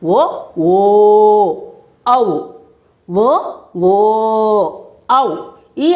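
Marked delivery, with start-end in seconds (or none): 0.0-1.5 short ఓ, then ఓ held long and level; teacher sounding out short then long ఓ. Shown as a demonstration. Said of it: "అవి ఏ అక్షరాలు?". 3.1-4.7 short ఓ, then ఓ held long and level; teacher sounding out short then long ఓ. Shown as a demonstration. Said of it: "అవి ఏ అక్షరాలు?".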